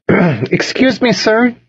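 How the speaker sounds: high, drawn-out receptionist voice; receptionist calling. A narrator's reading. Receptionist calling to a man in the waiting room, calling out. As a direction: call out slowly in a high-pitched voice. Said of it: "she called".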